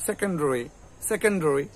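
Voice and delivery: সেকেন্ডারি as "se-ken-droi"; blurred "dori" ending on সেকেন্ডারি; alternative pronunciation. Shown as a demonstration. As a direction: reduce the "da" in সেকেন্ডারি to a quick "dro". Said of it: "এরকম করেও কিন্তু উচ্চারণটা হবে".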